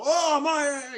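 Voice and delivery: high, loud mock-Australian outburst; pissed-off Australian. Comic imitates his angry Australian friends, mock-Australian shout. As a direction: burst out like an irate Australian. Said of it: "Australian friends are always, like, pissed off".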